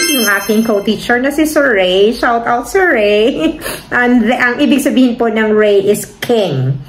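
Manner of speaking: ordinary talk, nothing marked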